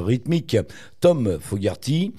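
normal talking voice

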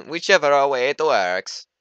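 put-on loud, high, slow Mario accent; bad Mario accent. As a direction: do a hammed-up Mario accent, drawn out. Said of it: "That was a really bad Mario accent".

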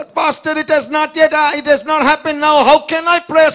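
high-pitched, insistent objection; protesting church member. Acted. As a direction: plead back at the pastor in protest. Acts doubting church member's protest. (acted-out congregant's complaint)

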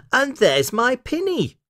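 put-on very high, delighted little girl; Lucy, excited cry. A narrator's reading. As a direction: pipe up high at a happy find. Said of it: "cried Lucy".

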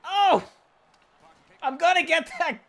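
high, loud eager cry; excited yelp. Real, not acted. Gamer chasing the puck, high excited yelp. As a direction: yelp as you chase the puck.

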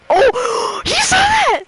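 very high gasping disbelief; gasp, then squeak the question; shocked gasp. Real, not acted. Shock after near fall.

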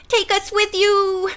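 put-on high, slow pleading child's voice; a small girl begging to come along; begging little girl. A narrator's reading. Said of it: "begged Flossie".

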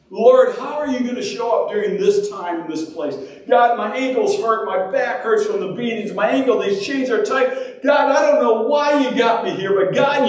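high, pained appeal to God; acted anguished prayer; pleading prayer. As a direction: plead upward as if hurting in chains. Acts out prisoners' pained prayer.

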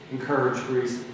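ordinary talk

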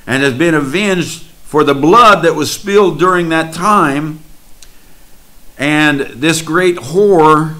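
deliberate sermon declaration, each phrase set apart; solemn preaching. Acted.

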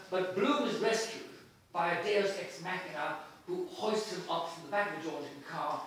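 ordinary delivery

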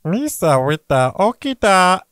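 slow goofy Gungan voice; Jar Jar Binks voice. Acted. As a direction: read it like Jar Jar. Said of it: "are you gonna read it out loud".

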